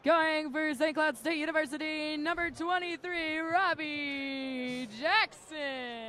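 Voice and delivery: slow, high, drawn-out arena announcer. Retold or imitated. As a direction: stretch out the player name like an arena announcer. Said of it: "a guy for the Huskies that's".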